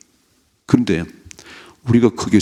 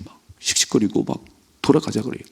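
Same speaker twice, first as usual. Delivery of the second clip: usual speaking voice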